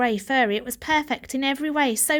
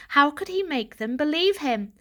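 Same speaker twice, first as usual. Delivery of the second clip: usual speaking voice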